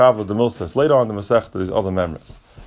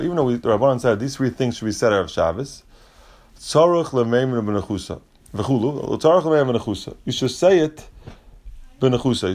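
ordinary delivery

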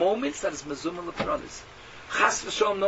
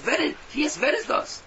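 startled, higher "who is that?"; bewildered question; 9 semitones higher than usual. Acted. The dead soul asking the angels, startled and high. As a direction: voice jumps up in confusion.

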